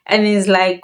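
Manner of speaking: ordinary talk, nothing marked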